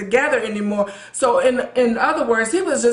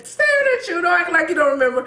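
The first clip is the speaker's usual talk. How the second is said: mocking high voice; 7 semitones higher, faster than usual. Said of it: "And saying".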